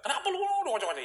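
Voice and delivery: tiru pelanggan yang kaget, nada melonjak tinggi. Retold or imitated. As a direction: teriakan kaget setengah main-main. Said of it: "begitu dia kaget". (pelanggan kaget mendengar penjual jatuh, nada tinggi)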